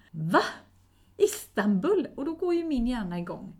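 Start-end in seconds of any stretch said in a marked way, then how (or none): 0.1-2.1 shocked. Retold or imitated. Retold shocked reaction to trip.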